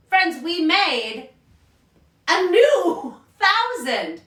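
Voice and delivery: delighted, laughing big news; excited reveal. Real, not acted. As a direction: announce it like a thrilling discovery. Big reveal of a new thousand.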